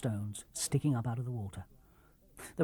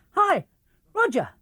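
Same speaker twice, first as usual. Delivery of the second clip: high bright call across the rocks; 4 dB louder than usual. A narrator's reading. Girl calls to her brother across the island, high and loud. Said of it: "she called".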